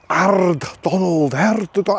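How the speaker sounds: drawn-out mock Scottish accent; generic Scottish accent. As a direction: stretch it into a hammy Scottish accent. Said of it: "stereotypical generic Scottish accent".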